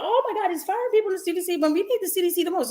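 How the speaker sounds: high, fast, panicky mocking voice. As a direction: parody the people freaking out, fast and high. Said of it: "And they were like".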